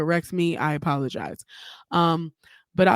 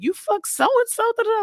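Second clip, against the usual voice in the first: high accusing quote; 3 dB louder than usual. Retold or imitated. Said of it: "was like".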